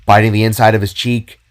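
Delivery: plain reading voice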